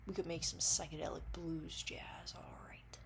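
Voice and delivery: very soft, whispery musing; hushed whisper. Pretend. Dreamer proposing a jam to an imagined partner, whispered. Musing aside about making music.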